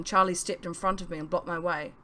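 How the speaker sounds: ordinary delivery, nothing marked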